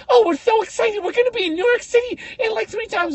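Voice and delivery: very high squeaky mock of the sisters; high lisping parody of thrilled sisters; mock excited sisters. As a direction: squeak it out like giddy morning people. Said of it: "trying to do the Mike Tyson fucking voice".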